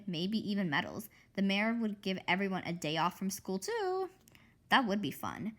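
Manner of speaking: plain reading voice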